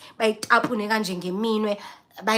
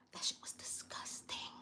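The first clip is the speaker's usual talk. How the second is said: whisper; 26 dB quieter than usual. Real, not acted. Whispered aside.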